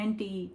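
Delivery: एंटी ending in "ee"; Indian pronunciation. Shown as a demonstration. Teacher recapping Indian usage, एंटी said "AN-tee". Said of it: "हम India में से बोलते हैं".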